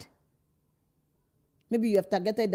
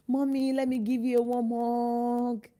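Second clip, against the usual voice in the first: put-on child sweet-talking; 5 semitones higher than usual.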